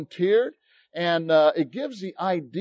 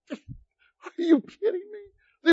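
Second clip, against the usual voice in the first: much higher, slow, crying-out wonder; giddy cry of disbelief; 12 semitones higher, much slower than usual. Retold or imitated. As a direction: stretch it out like he can't believe his luck. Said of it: "became giddy".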